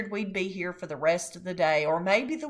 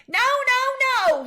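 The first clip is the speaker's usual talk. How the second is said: put-on high, loud child's yell; toddler hollering; 8 dB louder, slower than usual. A narrator's reading. Fudge hollering at the salesman in a tantrum. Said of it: "he hollered".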